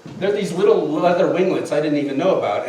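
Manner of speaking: usual speaking voice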